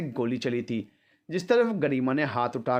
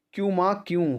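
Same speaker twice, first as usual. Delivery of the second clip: high, pleading challenge; protesting; 7 semitones higher than usual. A narrator's reading. Son asks mother why he must stop, high protest. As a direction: throw the question back, pitched high.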